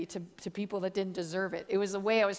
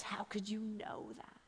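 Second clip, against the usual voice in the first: hushed, near-whispered mock weeping; 13 dB quieter than usual. Drug dealer to the woman who saw his past, weeping. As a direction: breathe it out softly as if in tears. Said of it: "starts weeping. He says".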